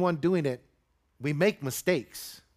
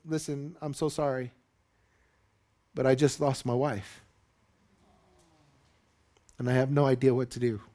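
grieving apology; 3 semitones lower than usual. Retold or imitated. Grieving father apologizes on bus.

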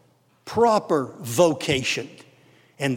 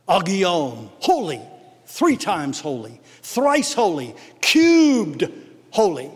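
preaching; slower than usual. Acted. Pastor extolling God's holiness to congregation, fervent preaching. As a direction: proclaim each word of praise.

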